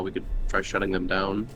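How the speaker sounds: usual speaking voice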